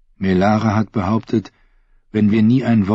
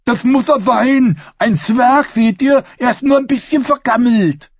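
simple fool voice; 5 dB louder than usual. A narrator's reading.

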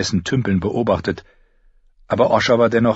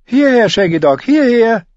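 high, loud call to the wolf; boy calling his brother's wolf back; 7 dB louder than usual. A narrator's reading. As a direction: shout it into the woods. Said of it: "rief er".

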